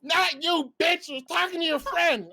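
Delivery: high mock-indignant put-down; sudden rude snap. Friend rejects the shy girl from the car, sudden rude snap. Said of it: "he immediately goes from, like, super chill".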